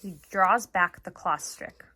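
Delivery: reading a novel aloud to a class; story narration. A narrator's reading. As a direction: tell the story steadily to young listeners. Teacher reads novel aloud.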